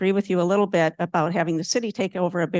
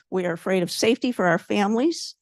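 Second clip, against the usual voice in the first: ordinary talk, nothing marked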